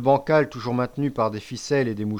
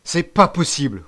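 loud, higher, stressed outburst; roaring disbelief; 4 semitones higher, 8 dB louder than usual. A narrator's reading. Chief refusing to believe the disaster, roaring. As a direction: roar it out, punching the refusal. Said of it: "rugit-il".

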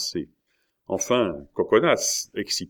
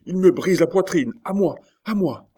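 high-pitched urgent cries; cry for help; 11 semitones higher, 6 dB louder than usual. A narrator's reading. Young man crushed in his enemy's grip, crying out. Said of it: "s'écria le jeune homme".

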